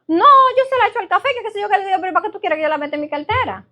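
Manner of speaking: fast, high, rattled-off friend; friend's voice. Retold or imitated. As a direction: chatter it out like the neighbor lady. Imita a la amiga. (la amiga, aguda y rapidísima)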